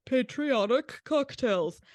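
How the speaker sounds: slow, drawn-out mock-patriotic proclamation; put-on patriotic tone. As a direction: stretch it out like a pompous patriotic slogan. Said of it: "did they say that in that voice".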